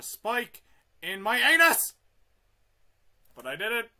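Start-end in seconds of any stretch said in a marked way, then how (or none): 0.0-1.9 yelling as the spike hits; pained yelp. Real, not acted. To the viewers after a spike hit, a yell building high. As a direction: climb into a loud high yell of pain.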